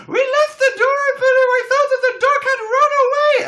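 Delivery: very high, loud relieved shriek; mock shrieking neighbour; neighbour overjoyed. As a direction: screech with wild relief. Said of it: "they were like".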